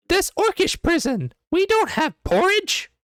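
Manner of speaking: very high, loud squeaky orc voice; orc guard. Acted. Orc guard tells the party there's no porridge, high squeaky voice. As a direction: orc flatly refusing. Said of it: "and says".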